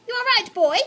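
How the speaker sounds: high, loud, delighted trill. Acted. Aunt Greta delighted by the offer, trilling high. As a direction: trill it with glee. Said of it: "she trilled".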